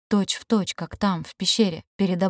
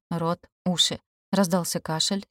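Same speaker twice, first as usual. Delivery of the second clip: ordinary delivery, nothing marked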